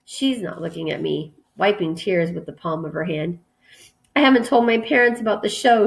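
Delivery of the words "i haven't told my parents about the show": higher-pitched, hurried admission; quick confession. A narrator's reading. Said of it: "she says quickly".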